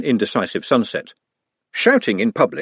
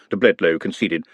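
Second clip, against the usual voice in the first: plain reading voice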